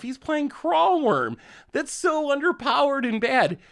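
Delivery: high, slow whiny opponent voice; pitying, exaggerated complaint; mock whiny opponent.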